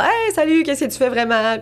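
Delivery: mock friendly hello. Acting out her own DM.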